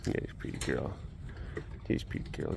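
barely voiced, soft coo to a tiger. Real, not acted. Cooing to the tiger, a soft whisper. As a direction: whisper sweet praise to the cat.

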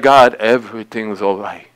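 very low, quiet, worn-out reassurance; feeble reassurance. Acted. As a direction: weakly pretend to God that all is fine. Feigned okay while hurting, to God.

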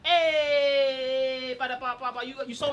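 long, high, drawn-out call; distracting shout. Friend distracting staff for a theft, long high holler. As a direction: stretch it out high to grab attention.

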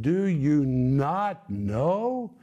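very slow, drawn-out questions; preacher voicing Paul pressing the Corinthians; emphatic rebuke. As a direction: stretch every word to make them get it. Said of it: "Paul is literally saying".

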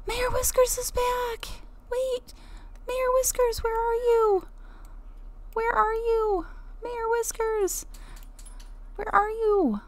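high-pitched eager calling out; calling for a lost cat, high and excited. Real, not acted. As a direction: call the cat's name, high and urgent.

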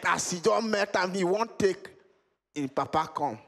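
ordinary delivery

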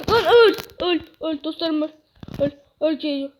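sudden loud, high outbursts; panicked shouting. Real, not acted. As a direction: yell in alarm as danger appears. Sudden threat appears in game. (panikle bağırarak)

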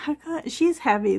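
out of breath from lifting; breathy, winded from the weight. Real, not acted. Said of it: "That's why I'm out of breath".

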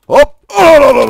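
high, loud rapid-fire cry; frantic battle yell. Real, not acted. Player attacking in a game, frantic yelling. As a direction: yell the syllables in an excited burst.

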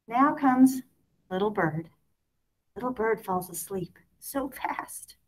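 ordinary delivery, nothing marked

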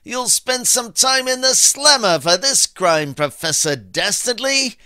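loud, high-pitched tough detective; Nick Guy. Shown as a demonstration. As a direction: threaten the villain in a big detective voice. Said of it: "Nick Guy is more inclined to use phrases like".

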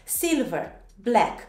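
each English word set apart, emphatic swinging tune; repeat-along pronunciation drill. Shown as a demonstration. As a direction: lead learners through a colour list, word by word. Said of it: "pronunți totul odată cu mine". (model de pronunție, rostit apăsat, cu pauze)

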